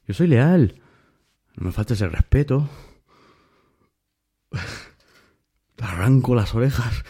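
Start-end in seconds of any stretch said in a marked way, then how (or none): 0.1-0.8 loud, firm, leaning on soy; emphatic declaration. Real, not acted. Proud boast about loyalty. 5.8-7.0 mock threat through a laugh; playful threat, chuckling. Real, not acted. Jokey threat while laughing.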